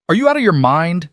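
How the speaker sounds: high, loud furious yell; furious shout at reckless Julian. Acted. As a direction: tear into him, terrified and enraged. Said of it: "Adrian shouted at Julian".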